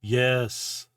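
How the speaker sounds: big, loud single-word pronouncement; resounding, weighty declaration; booming voice. Acted. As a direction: boom it out like a giant. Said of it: "you just hear this booming voice".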